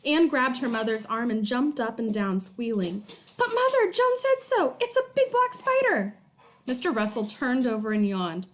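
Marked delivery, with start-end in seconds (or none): 3.4-6.2 put-on high, excited little girl; pleading little girl voice; child pleading. A narrator's reading. Girl insists to mother, high and pleading.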